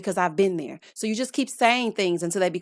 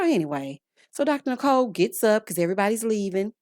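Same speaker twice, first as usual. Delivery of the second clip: ordinary delivery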